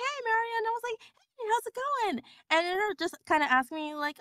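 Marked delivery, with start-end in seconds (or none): 0.0-2.2 high, chirpy hello; high cheerful greeting; bright retold greeting. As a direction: greet them like a friend, sing-song.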